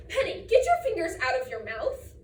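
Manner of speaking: mom's exasperated order; scolding mom. Acted. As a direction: interrupt sharply like a mom correcting her kid. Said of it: "My mom thinks I should say".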